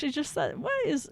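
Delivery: usual speaking voice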